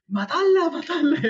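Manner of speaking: high, urgent yelled urging; frantic shouting. Retold or imitated. Flatmates during the cockroach fight, frantic high yell. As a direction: scream to kill the bug. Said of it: "Aquello fue un".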